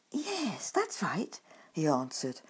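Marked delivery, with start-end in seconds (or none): 0.1-1.4 nervous. A narrator's reading. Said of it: "nervously shuffling his feet".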